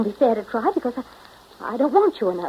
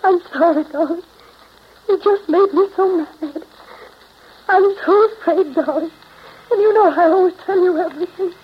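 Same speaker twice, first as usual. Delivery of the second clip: frightened pleading; 7 semitones higher, 5 dB louder than usual. Acted. Bella distraught after quarrel with Jim.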